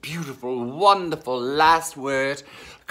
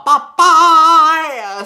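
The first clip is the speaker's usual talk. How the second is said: a high, loud, sing-song bye bye; sing-song goodbye; 11 semitones higher, 10 dB louder than usual. Real, not acted. Host trilling goodbye to viewers, high loud sing-song. Signing off the show.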